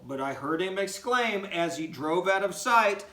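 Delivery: lively, singsong storybook reading; animated read-aloud for young listeners; reading to children. Acted. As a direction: read it like a bedtime story for kids. Teacher reads poem to children.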